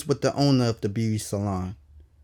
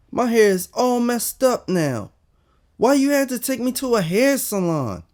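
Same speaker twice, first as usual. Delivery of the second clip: upset complaint; 9 semitones higher than usual. Acted. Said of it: "I said".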